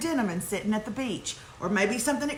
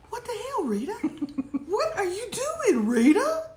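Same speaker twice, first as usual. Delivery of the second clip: half-laughing incredulous questions, pitched way up; mock scandalized; 9 semitones higher than usual. Host scolding the email writer Rita, mock scandalized.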